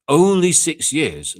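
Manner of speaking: loud, slow, outraged repeat; incredulous shout. Real, not acted. Fan shouting back at critics, loud and slow. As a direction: bellow it in disbelief.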